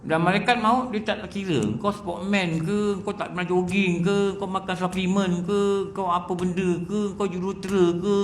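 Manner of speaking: rising sermon fervour, slowing to a point; preaching. Acted. Preacher warning the congregation that death comes for all, urgent. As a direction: hammer the list home before the congregation.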